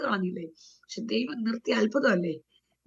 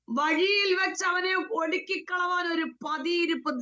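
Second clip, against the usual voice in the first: high-pitched, forceful verse reading; declaimed scripture; 6 semitones higher than usual. Real, not acted. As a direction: proclaim the verse in one raised run. Reads verse in preaching voice. (ഉയർന്ന സ്വരത്തിൽ വചനം പ്രഖ്യാപിച്ച് വായന)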